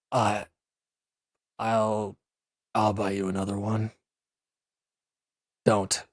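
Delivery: ordinary talk, nothing marked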